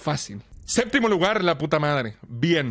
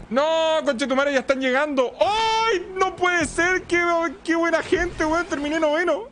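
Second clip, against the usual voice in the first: excited yelling; 11 semitones higher than usual. Real, not acted. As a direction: shriek with delighted disbelief. Surprise finish, yelling.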